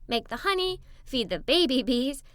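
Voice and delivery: usual speaking voice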